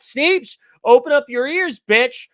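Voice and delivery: calling out. Real, not acted. Streamer calling out viewer Sneeps in a loud, high teasing yell. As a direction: yell at a chatter to listen up.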